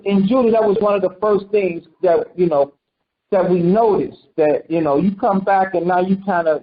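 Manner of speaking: ordinary talk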